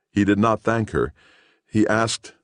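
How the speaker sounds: usual speaking voice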